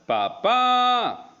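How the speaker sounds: "papá" with the final "pá" long, high and loud; stress on last syllable. Shown as a demonstration. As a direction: belt out and hold the "pá" ending "papá". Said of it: "El acento está en la última sílaba". ("papá" con "pá" alargado y en voz alta)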